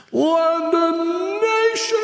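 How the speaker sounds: high, slow town crier call; royal herald. Shown as a demonstration. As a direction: stretch it out like a town crier announcing. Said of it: "the way I'm supposed to do it is".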